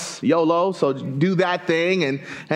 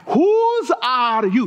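"Whose" held long and raised; emphatic question; 4 semitones higher, much slower than usual. Shown as a demonstration. Preacher repeating African greeting to congregation, slow stress on "Whose". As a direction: slowly repeat "Whose are you", leaning on "Whose". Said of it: "They say".

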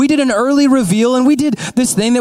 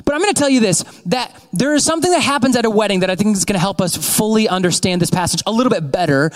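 usual speaking voice

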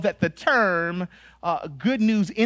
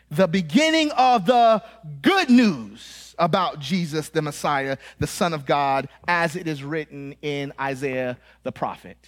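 emphatic scripture reading; 6 semitones lower than usual. Shown as a demonstration. Preacher showing the congregation how Mark opens, emphatic reading. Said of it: "none of you read it like that".

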